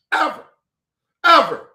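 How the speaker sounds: high, loud single-word burst; angry emphasis. Real, not acted. Insisting nobody else ever helped her, loud and angry. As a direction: fire the word out with finality.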